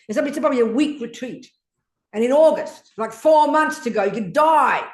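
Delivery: loud, rising urgent scolding; urgent rebuke. Real, not acted. Teacher warning a student he could die before August, loud and urgent.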